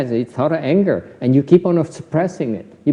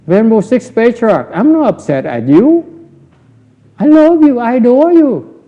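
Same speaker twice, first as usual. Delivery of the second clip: higher, syrupy flattery to the master; mock sweet pleading; 7 semitones higher, 4 dB louder than usual. Teacher voicing the student flattering the Patriarch, much higher. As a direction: gush over the teacher to hide the hurt.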